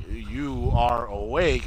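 very slow, drawn-out mock Cooper. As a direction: drag out each word like a dazed agent. Retelling Cooper waking, slow.

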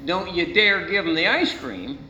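ordinary talk

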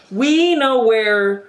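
ordinary delivery

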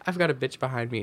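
ordinary talk, nothing marked

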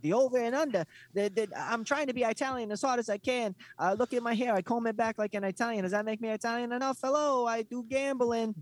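high-pitched mock Italian-wannabe voice; Yanni the Greek. Mocking the Greek announcer who tries to be Italian, in a high put-on voice. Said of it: "he has that weird voice".

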